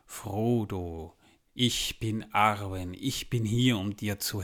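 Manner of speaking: a hint of a seductive elf; deep, sensual voice. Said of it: "sagt mit tiefer, sinnlicher Stimme".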